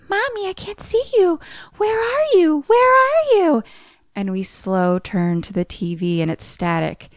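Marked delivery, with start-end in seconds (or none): 0.1-3.6 put-on very high, crying child's voice; lost little girl. Little girl trapped in the TV crying for mom, high and tearful. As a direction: plead like a lost small girl.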